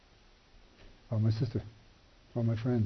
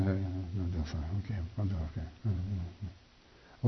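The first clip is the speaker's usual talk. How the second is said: mumbled greeting; 5 semitones lower, 11 dB quieter, much slower than usual. Retold or imitated. Lonely greeting with coworkers.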